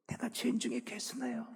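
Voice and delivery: breathy whisper of a broken sinner; hushed, ashamed whisper; whispered confession. Retold or imitated. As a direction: whisper it as if too ashamed to speak. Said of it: "눈물이 터져 나오기 시작해요".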